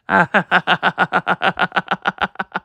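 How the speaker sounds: long, even staccato ha-ha laugh; acted laughing fit. Luffy hit by laughter paint, acted laughing fit. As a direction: put on a helpless run of laughter.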